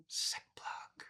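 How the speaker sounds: breathy whisper, no voice; whispered self-plug; whispered aside. Real, not acted. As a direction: slip in the plug under your breath. Aside plugging previous episode.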